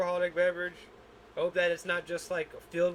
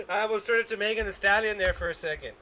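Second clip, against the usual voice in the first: laughing; 3 semitones higher, 3 dB louder, faster than usual. Real, not acted. Laughing after coughing.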